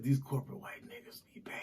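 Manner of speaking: hushed, conspiratorial whisper. Real, not acted. Sharing a touchy opinion with co-hosts, whispered. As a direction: lean in and whisper it. Lowering voice for confession.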